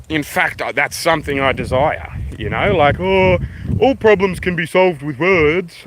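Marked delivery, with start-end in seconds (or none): 3.0-5.8 high, drawn-out mock preachiness; mocking naive idealist. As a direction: stretch it out in a put-on, raised voice. Mocking a naive pacifist view.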